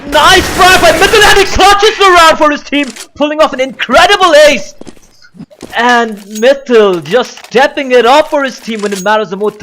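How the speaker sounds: high, loud hype call for the ace; excited esports caster. Acted. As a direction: scream the clutch like a caster.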